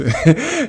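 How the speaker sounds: short high burst of laughter. Real, not acted. Laughs at own story.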